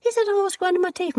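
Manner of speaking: telling the husband in disbelief; incredulous report. Retold or imitated. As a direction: rush it out in disbelief.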